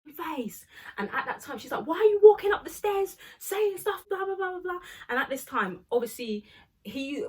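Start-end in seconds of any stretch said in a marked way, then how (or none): 0.0-0.7 lightly acted angry challenge, pitched up; mock snappy confrontation, higher voice; Hannah snapping. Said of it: "Hannah just snaps". 1.9-4.8 high, loud imitated telling-off; mock outraged challenge; Hannah snapping. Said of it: "Hannah just snaps".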